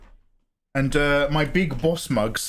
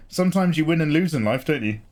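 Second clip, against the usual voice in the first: ordinary delivery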